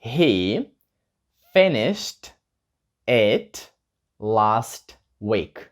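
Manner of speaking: slow, careful model answer, word by word; slow model sentence. Shown as a demonstration. Teacher modelling the answer slowly for students. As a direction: spell it out slowly for learners. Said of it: "закінчив це минулого тижня".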